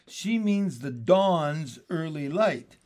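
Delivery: over-pronounce every word to mock; exaggerated distinctness. A narrator's reading. Beezus mockingly corrects her sister, each word overdone. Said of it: "pronounced each word with exaggerated distinctness".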